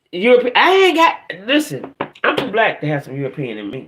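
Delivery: normal talking voice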